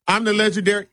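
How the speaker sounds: normal talking voice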